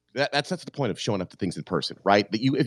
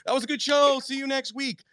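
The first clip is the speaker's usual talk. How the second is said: high, breezy sign-off; mock cheery brush-off; 12 semitones higher, slower than usual. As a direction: toss it off like a bored host wrapping up. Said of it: "And it was like".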